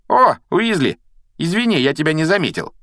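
high-pitched fake apology with a smirk; mocking sneer. A narrator's reading. Malfoy jeers at Ron after hitting him from behind, mocking. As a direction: feign surprise, mock him with a false sorry. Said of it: "была издевательская усмешка".

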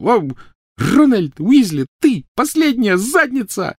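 high, loud, slow furious yell; furious girl. Acted. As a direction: spit each word out with a blow. Hermione beating Ron.

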